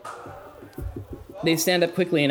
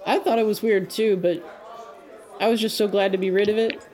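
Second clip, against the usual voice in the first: higher, lighter character voice; NPC voice; 7 semitones higher than usual. Acted. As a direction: play the nervous NPC, quickly. NPC speaking to players.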